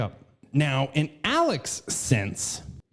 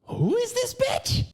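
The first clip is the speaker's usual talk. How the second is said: very high, incredulous mock question; mock incredulity. Comedian voicing suspicious public, mock incredulity. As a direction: shoot the question way up in pitch.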